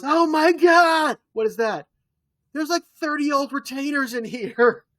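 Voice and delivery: high, loud, stretched-out disgusted gasp; mock shocked shout. [name] finding thirty old retainers in a hammed-up shocked shout. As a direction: shriek at the gross discovery.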